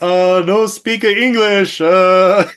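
slow, high mock foreign villager; broken English villager. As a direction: ham up a villager who barely speaks English. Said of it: "they're like".

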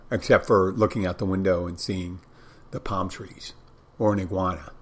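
usual speaking voice

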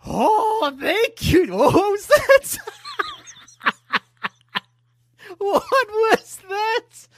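very high, slow put-on candlestick voice; talking candlestick. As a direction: squeak it out like a tiny candlestick. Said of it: "Do the voice".

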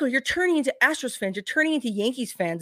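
high, sing-song mocking complaint; mock whiny fan. Mocking fans accusing others of becoming Yankees fans. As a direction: parody a sulky fan.

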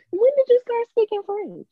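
squeaky joking high pitch; high mock voice. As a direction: jump way up in pitch.

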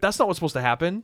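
high, quick worried fretting; mock panic. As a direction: fret quickly as an alarmed Moses. Moses panicking at the mistake.

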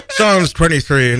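ordinary talk, nothing marked

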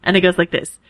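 ordinary talk, nothing marked

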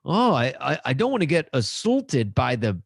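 put-on Canadian accent. To co-host, goofing in a mock Canadian accent. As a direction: play a jokey Canadian voice saying hey guys. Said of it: "You sound like a Canadian".

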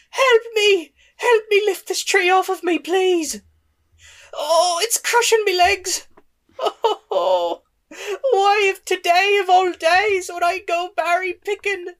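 put-on high, loud pleading old woman; trapped old elf woman. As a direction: squeal in pain under the tree.

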